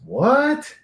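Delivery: high, startled what; a jolt of disbelief; surprised. Real, not acted. Shocked at Swedish claim.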